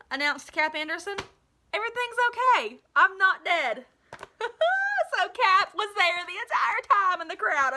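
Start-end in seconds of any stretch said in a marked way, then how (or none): 1.7-3.9 high, loud triumphant reveal; ta-da, I'm alive; cheerful higher announcement. A narrator's reading. Cap reveals himself triumphantly. 4.1-7.7 high, loud laughing talk; amused reader laughing at the twist; words tumbling out in laughter. Real, not acted.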